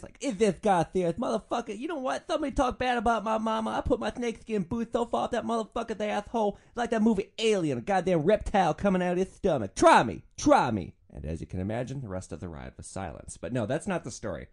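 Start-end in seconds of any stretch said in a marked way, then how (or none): 0.2-10.9 high, loud, ranting pimp persona; Big Daddy Caddy. Acted. Driver threatening passengers who pissed him off, ranting pimp voice. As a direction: rave like an unhinged would-be pimp. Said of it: "I'd do it in the Big Daddy Caddy voice".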